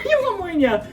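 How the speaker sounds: high-pitched sped-up replay; squeaky replay. Real, not acted. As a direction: echo it in a small, squeaky voice. Squeaky comic repeat of line.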